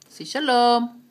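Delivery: loud, coaxing command. Acted. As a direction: call it out to the bird. Coaxing parrot to speak.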